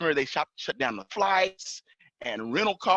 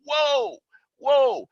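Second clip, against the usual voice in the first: high, startled retold whoa; alarmed protest; 11 semitones higher, 5 dB louder than usual. Driver reacting to an officer yelling at him, alarmed. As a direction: re-enact being taken aback, high and drawn out.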